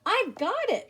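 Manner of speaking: high, bright eureka cry; mild mock excited yell. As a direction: burst out with the idea. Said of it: "Earl yelled with excitement".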